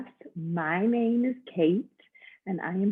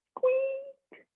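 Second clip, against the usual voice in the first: very high, tiny squeaking voice; mouse squeak. Acted. The mouse answering from the pillow, tiny high squeak. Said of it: "the little mouse says".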